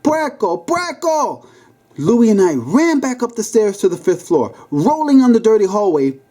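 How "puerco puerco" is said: high, frenzied repeated shrieks; frantic yelling. A narrator's reading. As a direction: chase them down screaming. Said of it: "she frantically yelled".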